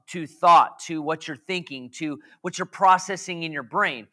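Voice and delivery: ordinary talk, nothing marked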